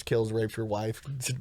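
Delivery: normal talking voice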